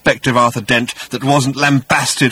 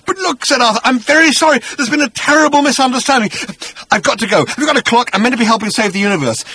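higher, hurried panicky apology; flustered Arthur; 13 semitones higher, 5 dB louder, faster than usual. Acted. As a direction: babble an excuse to get away. Arthur flustered trying to leave.

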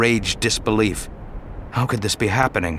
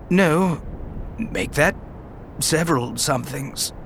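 higher, fading, wry and wounded; weak, joking Obi-Wan; 5 semitones higher, 4 dB quieter than usual. Acted. Wounded Obi-Wan jokes to Anakin, fading softer. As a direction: joke through the pain, fading out.

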